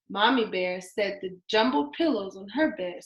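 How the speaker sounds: ordinary delivery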